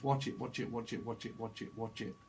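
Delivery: watch it, fading into a hush; fading murmur. Pretend. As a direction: let the words sink away to almost nothing. Channelled message fading to a murmur.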